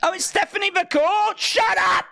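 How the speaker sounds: high, loud outburst; irritated shout. Real, not acted. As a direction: yell over the interrupting voice. Yells over an interrupting voice assistant.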